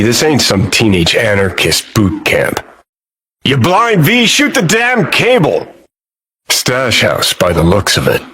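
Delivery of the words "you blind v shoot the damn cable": high-pitched, exasperated shout; urgent yell. Acted. Yelling at partner to shoot the cable, urgent yell. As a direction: yell an order in the heat of action.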